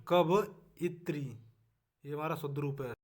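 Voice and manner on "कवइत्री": कवइत्री split slowly as क-व-इ-त्री; teacher spelling कवइत्री slowly; syllable breakdown. Shown as a demonstration. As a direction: stretch कवइत्री to show each vowel. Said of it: "अब है कवित्री".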